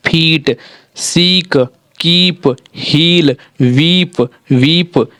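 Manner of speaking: "फीट, सीक, कीप, हील, वीप, वीप" each with a stretched ई; long drawn vowel. Shown as a demonstration. Teacher reading double-e examples to learners, long drawn vowels. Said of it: "थोड़ा सा आवाज़ को ज़्यादा खींचना चाहिए".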